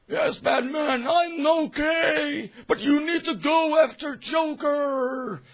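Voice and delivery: high, loud, slow pleading shout; urgent Gordon. Retold or imitated. Gordon urges Batman to chase Joker, high strained shout. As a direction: urge him on, straining. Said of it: "is like".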